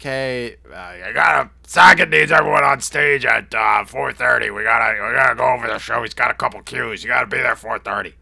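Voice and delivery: loud, urgent shouted orders; lightly frantic impression of a shouting promoter; Pat on the phone. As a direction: shout it like a stressed event boss. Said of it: "Pat from Live Nation called and he was like".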